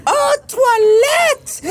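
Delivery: high, slow cry of realization; waitress finally understands, high surprised cry; sudden realization. Retold or imitated. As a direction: finally get it, with a big cry. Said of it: "And she said".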